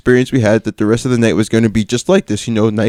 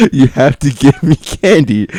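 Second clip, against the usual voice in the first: slower, insisting through laughter; laughing repeat; 4 semitones higher than usual. Retold or imitated. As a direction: repeat the demand while cracking up. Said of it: "It's a rule".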